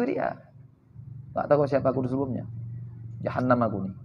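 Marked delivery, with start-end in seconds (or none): 1.3-3.9 low, subdued self-reproach; gloomy. Acted. Gloomy man condemning himself when asked, dejected and quiet. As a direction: grumble dejectedly under your breath, acted. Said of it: "Model-model begitu".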